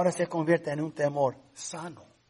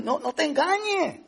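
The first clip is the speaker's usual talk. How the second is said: high, pointed challenge in a borrowed voice; a lightly voiced James, questioning sharply; voicing the apostle James; 9 semitones higher than usual. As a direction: challenge them as James would. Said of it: "por eso Santiago dice".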